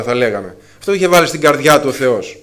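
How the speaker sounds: ordinary delivery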